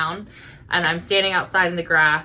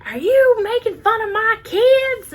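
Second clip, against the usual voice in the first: high, loud, drawn-out mock yell; imitated yelling; 6 dB louder than usual. Tattooed woman confronting the niece about her kids, imitated yelling. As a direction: mimic an irate mother, pitched way up. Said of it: "she's yelling at my niece".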